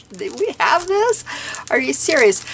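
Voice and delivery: high, giddy delight; excited disbelief. Retold or imitated. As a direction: sound like you just found treasure. Thrilled at the new store.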